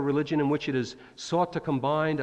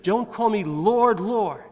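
pleading call; 6 semitones higher, 5 dB louder, slower than usual. Retold or imitated. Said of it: "Jesus said".

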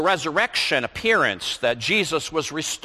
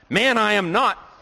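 indignant denial; 8 semitones higher than usual. Acted. Peter denies to accuser.

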